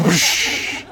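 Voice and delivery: long, airy imitated whoosh; drawn-out whoosh sound effect. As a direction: make a whoosh that trails into a hiss. Imitates something rushing by.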